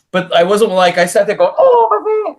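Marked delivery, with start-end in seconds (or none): 1.6-2.4 high-pitched mock overreaction; melodramatic, squealed-up mock shock; making a big deal. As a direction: fuss as if badly hurt, hammed up. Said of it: "make a big deal".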